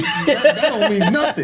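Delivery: scoffing, high and laughing; a coach laughing off the boast. Retold or imitated.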